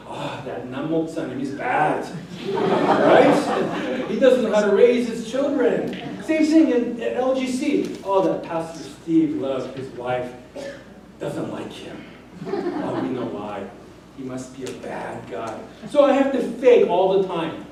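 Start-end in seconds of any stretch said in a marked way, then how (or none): 0.1-2.1 lower, quick gossiping tut with a laugh; mock church gossip. Voicing church gossip about pastor. 8.0-15.6 low, hushed gossiping voice, partly whispered; mock gossip. Voicing gossip about Pastor Steve.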